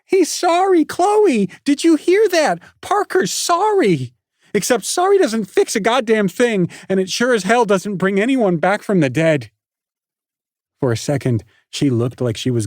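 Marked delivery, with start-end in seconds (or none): 0.1-9.5 much higher and louder, scornful mockery; mock his apology to Chloe; fuming sarcasm. A narrator's reading. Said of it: "she fumed".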